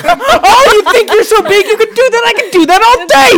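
high, loud mocking challenge; taunting shopkeeper. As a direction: shopkeeper mocking Dak after the chicken kill.

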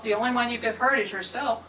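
ordinary talk, nothing marked

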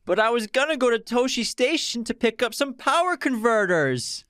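put-on very high, whiny young man's complaint; whiny Luke. Quiz host quoting a movie line, whiny Luke voice. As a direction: whine it like a sulky farm boy. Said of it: "In a very whiny voice".

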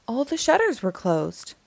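plain reading voice

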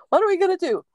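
high and loud anxious question; retelling her own alarm at the dark entity, high and loud. Retold or imitated. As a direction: blurt it out in a panic.

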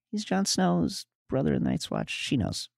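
ordinary delivery

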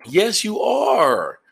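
high, drawn-out playful shout; loud, high-pitched mock accusation. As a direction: shout it slowly at the listener, teasing. Playfully confronts the imagined listener.

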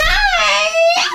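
very high, drawn-out squealed hi; smitten greeting. Acted. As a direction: squeal hello like you're smitten. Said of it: "I'm gonna be like".

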